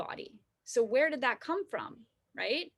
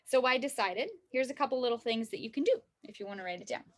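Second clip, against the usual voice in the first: ordinary talk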